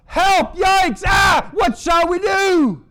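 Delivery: very high, loud mock-panicked shouts; panicking. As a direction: shriek it out in hammed-up distress.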